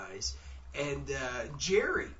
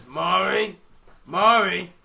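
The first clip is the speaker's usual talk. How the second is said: loud, slightly raised call for mom; imitating the recorded call; 4 semitones higher, 12 dB louder, much slower than usual. Shown as a demonstration. Investigator tests whether he matches the recording, loud imitated call. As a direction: call out in your own voice, imitating a child's call. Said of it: "Let me try to do it".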